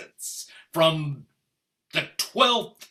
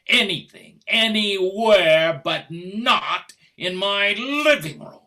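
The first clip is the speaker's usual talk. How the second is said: rising anger; 3 semitones higher, 7 dB louder than usual. Acted. Husband's tirade builds.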